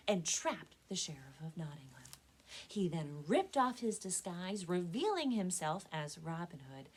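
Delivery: ordinary delivery, nothing marked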